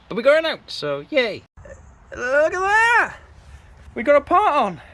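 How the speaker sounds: high, loud, triumphant cheer; excited. Real, not acted. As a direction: celebrate the small win. Celebrates getting the part out.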